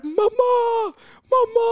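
very high, loud panicked cry; screaming for mum. Acted. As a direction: re-enact a desperate shriek for your mother. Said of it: "jeg driver og skriker og skriker etter mamma". (høyt, skingrende skrik etter mamma)

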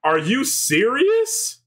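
high, drawn-out disbelief; incredulous. Real, not acted. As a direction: question it in stunned disbelief. Shocked by the game's ending.

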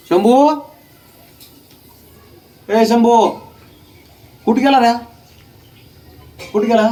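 high, loud call for the dog. Real, not acted. Owner calls loudly and high for his missing dog Shambhu.

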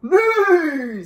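loud, high, drawn-out whinny; big playful horse noise; horse neigh. Acted. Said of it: "said Horse".

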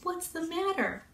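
high, soft, caring question; concerned cat character. A narrator's reading. Cat finds Bunny crying, soft concerned question. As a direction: ask softly in a little cat voice. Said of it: "asked Cat".

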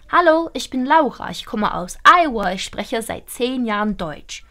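crisp German vowels, uvular r in spreche; native German accent. Shown as a demonstration. Teacher modelling an authentic German accent for learners. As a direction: say it like a real German speaker. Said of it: "You want to sound like a German".

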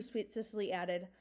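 usual speaking voice

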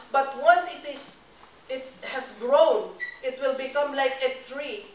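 loud, driving sermon voice; preaching at full force. Acted. Pastor urging the congregation to grow their faith, full-force preaching. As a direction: preach it out to the whole room.

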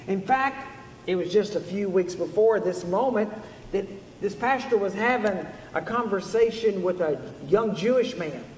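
ordinary delivery

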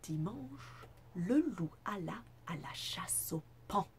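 measured, lilting picture-book reading with big pitch swings; animated bedtime-story narrator; storybook narration. A narrator's reading. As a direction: read it to children, savouring key words. Reads a picture book aloud.

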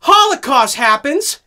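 high, loud proclamation; dramatic announcement. Acted. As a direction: announce it for the camera. Repeated for the camera.

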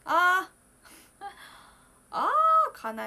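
high wailing sigh, half laughing; crying out. Real, not acted. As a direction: let out a teary cry. Emotional sigh on stream. (泣きそうな声)

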